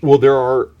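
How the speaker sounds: ordinary talk, nothing marked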